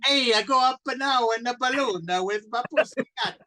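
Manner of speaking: put-on hammy Italian accent, high and loud; over-the-top Italian accent impression. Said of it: "doing an outrageous Italian accent".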